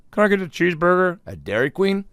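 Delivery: mock customer voice. Imagined odd customer.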